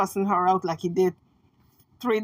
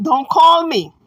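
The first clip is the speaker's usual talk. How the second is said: higher, louder blunt command; firm warning; 6 semitones higher, 7 dB louder than usual. Retold or imitated. Relaying his message to the women, firm warning. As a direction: warn them off sharply. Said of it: "That's the message".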